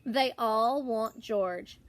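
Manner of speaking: plain reading voice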